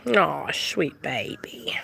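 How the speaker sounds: soft, low, slow breath of affection. Real, not acted. Owner doting on husband's dog, tender low coo. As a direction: half-whisper fondly to a pet.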